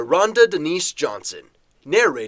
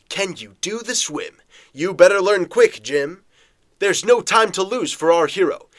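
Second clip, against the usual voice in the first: usual speaking voice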